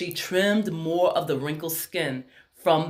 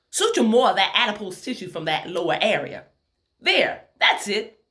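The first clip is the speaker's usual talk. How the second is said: put-on Tennessee accent; 4 semitones higher, 4 dB louder than usual. A narrator's reading. Surgeon instructing during a facelift, strong Tennessee accent. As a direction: direct the operation in a Tennessee accent. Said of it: "in a strong Tennessee accent".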